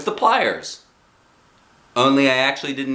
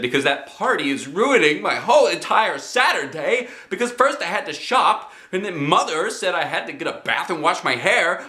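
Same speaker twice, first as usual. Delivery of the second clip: tearful complaining; 8 semitones higher than usual. A narrator's reading. Girl crying out her reasons.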